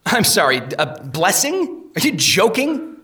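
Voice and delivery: rising, disbelieving question; can't believe what he's hearing; incredulous. Acted.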